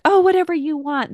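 high, loud, airy shrug of a line; mock carefree pushover; breezy whatever. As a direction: toss it off like nothing matters. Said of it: "laissez-faire and they're just like".

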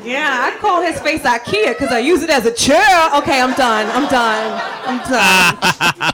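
loud, punchy stand-up set; stand-up delivery. Acted. On stage joking to the crowd about her husband, loud and punchy. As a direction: work the crowd with big energy.

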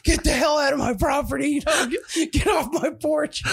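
high, hammed-up outraged homeowner; high-pitched shouted eviction with laughter creeping in; mock angry shout. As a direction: imitate chasing someone off, laughing as it breaks up. Homeowner ordering him off the porch.

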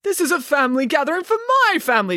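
high-pitched indignant father; acted protest, voice pushed up; indignant declaration. A narrator's reading. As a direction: declare it in exasperation. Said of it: "declared Mister Green".

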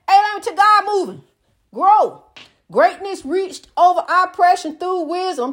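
hollering sermon at the ducking pew; fiery preaching. Acted. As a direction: rouse the congregation, calling them out. Preaching at full force.

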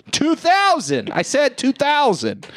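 high-pitched mock insistence; obnoxious bid, pitched right up; insistent heckler. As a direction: imitate a bidder stubbornly talking over the auctioneer.

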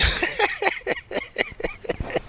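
high, soft wheezy laugh. Real, not acted. Host laughing at his own car story, wheezy laugh. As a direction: laugh softly up high, for a couple of seconds.

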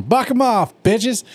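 rally like a cowgirl; hammed-up battle cry; mock Applejack yell. Quoting Applejack.

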